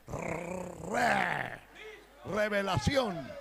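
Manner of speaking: revelación with a hard trilled R, said slowly; strong rolled R. Shown as a demonstration. Preacher demonstrating to the congregation, trilling the R in revelación. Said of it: "Que suene fuerte la R".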